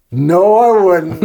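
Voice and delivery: slow, drawn-out concession; reluctant admission. Retold or imitated. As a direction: give in slowly after thinking it over. Karen admits after thinking a minute.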